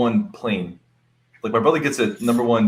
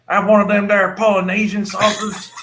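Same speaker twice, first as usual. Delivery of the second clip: put-on country accent; 8 semitones higher, 3 dB louder, slower than usual. Joking to friends about the sauce, in a country accent. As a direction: lay on a country accent, pitched up. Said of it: "You sound like my uncle".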